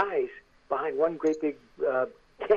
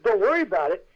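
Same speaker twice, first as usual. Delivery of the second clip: louder, higher disbelieving echo; incredulous echo; 4 semitones higher, 6 dB louder than usual. Retold or imitated. Unprepared actor to crew, incredulous echo. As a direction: throw the reassurance back, raised voice.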